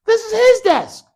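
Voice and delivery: his said high and loud; stress on his. Shown as a demonstration. Teacher showing the class how to stress his. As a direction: punch the word his. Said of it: "We're gonna emphasize the point".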